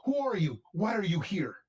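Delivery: a nervous spearman demanding answers; scared but brave villager. Acted. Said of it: "He goes".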